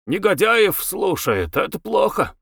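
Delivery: high, mildly doleful complaint; understated gloom; glum. A narrator's reading. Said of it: "дядя Хрисанф уныло сказал".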